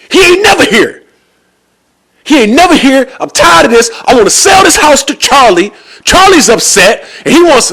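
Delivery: high-pitched, loud ranting shout; imitated angry yelling. Fed-up house seller yelling at the receptionist, angry shout. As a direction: act out a man yelling his complaints at full tilt. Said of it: "he yelling at her".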